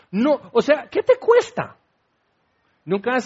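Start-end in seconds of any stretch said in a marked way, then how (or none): 0.1-1.8 high, whining complaint; whiny Moses. Acted. Moses complaining to God in a high whine. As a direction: whine like a fed-up servant pestering God. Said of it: "lo quejumbroso".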